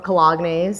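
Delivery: "colognes" said co-log-nayz; joke pronunciation. Pretend. Host listing perfumes and colognes, deliberately mispronouncing. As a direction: say "colognes" in three syllables, ending in nayz. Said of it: "I gotta say it the right way".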